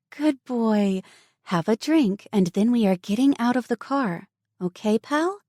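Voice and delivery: encouraging. A narrator's reading. Alex encouraging the boy after his drink, bright and coaxing. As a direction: cheer him on gently. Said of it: "she encouraged".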